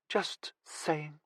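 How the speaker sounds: pouting. A narrator's reading. Girlfriend answers back after being teased, pouting. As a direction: say it softly with a pout. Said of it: "replied with a pout".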